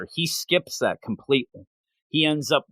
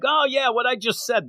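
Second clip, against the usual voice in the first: higher-pitched mock at oneself; self-mocking; 10 semitones higher than usual. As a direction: poke fun at your own blunder. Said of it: "he kinda makes fun of himself".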